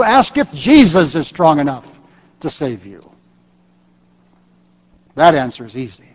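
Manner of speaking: ordinary talk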